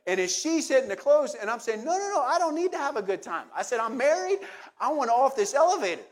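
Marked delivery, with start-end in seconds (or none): 1.8-3.5 high, urgent no no no; panicked refusal. Retold or imitated. To the woman hitting close, a high urgent refusal. 3.8-6.0 urgent plea. Retold or imitated. Demanding to get off.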